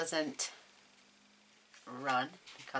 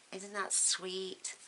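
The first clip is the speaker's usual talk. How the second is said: cooing; 6 semitones higher, 7 dB quieter, much slower than usual. Real, not acted. Admiring her finished card.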